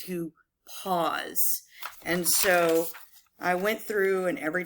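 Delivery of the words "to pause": a gap after "to", then a softer "pause"; "pause" set apart by a short silence; pause before "pause". Shown as a demonstration. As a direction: stop briefly before landing "pause". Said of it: "indicator that you need".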